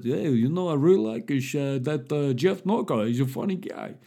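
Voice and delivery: put-on continental European accent; hammy foreign accent, mildly approving; Guy Verhofstadt. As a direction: do a comic European politician's accented English. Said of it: "Guy Verhofstadt come out and said".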